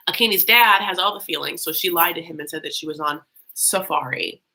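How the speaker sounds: ordinary talk, nothing marked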